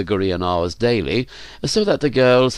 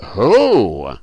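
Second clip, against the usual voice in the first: drawn-out, feigned innocent question; long swooping innocent query; innocent inquiry; 4 dB louder than usual. A narrator's reading. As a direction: ask it as if you had no idea. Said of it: "inquired Cernus innocently".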